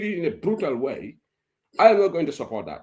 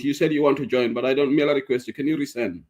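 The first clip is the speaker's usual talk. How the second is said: normal talking voice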